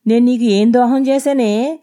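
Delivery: put-on a drunk husband picking a fight, loud and high; angry drunk husband accusing; drunken accusation. A narrator's reading. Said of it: "అన్నాడు".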